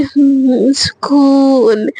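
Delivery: long drawn-out contented hum; blissful relief. Acted. Sejal scrolling reels on her returned phone, drawn-out bliss. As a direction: stretch the word out in blissful relief.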